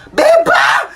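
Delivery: high startled yell; shocked shout. Acted. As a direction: cry out her name in shock.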